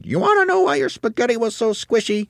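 very high, loud character voice; shrill wife voice. A narrator's reading. Mrs. Twit is about to reveal her trick, shrill wife voice. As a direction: taunt in a piping high voice.

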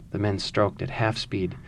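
calm, measured storytelling read; story narration. A narrator's reading. Reads the novel passage aloud.

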